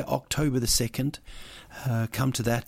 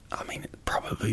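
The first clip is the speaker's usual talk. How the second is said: soft unvoiced aside; whispered aside; 5 dB quieter, faster than usual. Real, not acted. Host whispering a quick aside to listeners.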